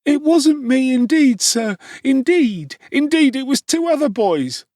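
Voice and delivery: put-on a frightened boy pleading with the officer. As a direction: beg desperately to be believed. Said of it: "clasping his hands passionately".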